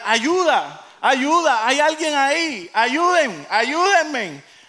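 high, loud cries for help; acted shouting for help. Jack hanging from a branch to anyone above, shouting. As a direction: call out desperately into the distance. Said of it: "Jack a gritar".

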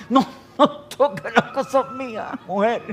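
mock complaint while cracking up; laughing protest. Acted quarrel, laughing. (protesta riendo, más lenta y aguda)

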